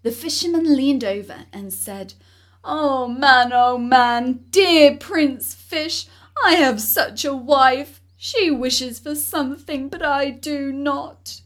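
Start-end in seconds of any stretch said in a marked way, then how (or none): 2.6-11.4 calling out. Acted. Fisherman calls to the fish.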